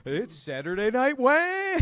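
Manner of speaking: high, ringing TV announcer parody; spoof show announcer. Pretend. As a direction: announce the show with stretched last word.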